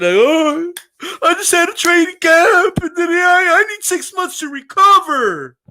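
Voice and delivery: high, loud, whiny sob story; hammed-up crybaby voice pleading for time; mock whining. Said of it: "You don't see him crying about it".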